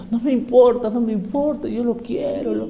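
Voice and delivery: imitación aguda y suave de una fan enamorada; suspíralo agudo, como admiradora terca; mujer embelesada. Acted. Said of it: "pero hay mujeres". (voz fingida de enamorada, aguda y bajita)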